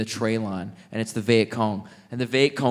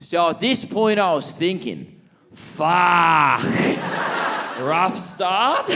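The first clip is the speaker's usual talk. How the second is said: put-on old Aussie bloke; 6 semitones higher, 3 dB louder, slower than usual. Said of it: "Jungle just goes".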